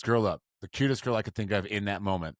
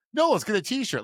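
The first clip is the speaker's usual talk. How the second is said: high, emphatic retold insistence; acted insistence; 12 semitones higher than usual. Teenage boy insisting on buying his date merch, acted insistence. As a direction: brush off the refusal eagerly.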